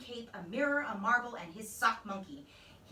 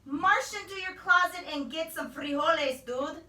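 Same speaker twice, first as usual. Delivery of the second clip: comet voice; 8 semitones higher, 7 dB louder than usual. A narrator's reading. Voice from comet, punning.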